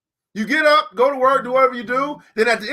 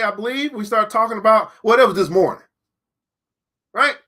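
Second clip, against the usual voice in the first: ordinary talk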